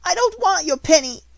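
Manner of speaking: high, loud tearful refusal; sobbing child. A narrator's reading. Lottie refusing Jessie's penny, high loud sobbing. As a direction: push the penny away through tears. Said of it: "sobbed Lottie".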